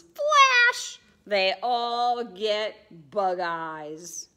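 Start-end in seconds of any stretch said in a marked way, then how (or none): 0.0-1.0 very high, loud burst; flash sound. A narrator's reading. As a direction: pop it like a camera flash. Sound effect in story.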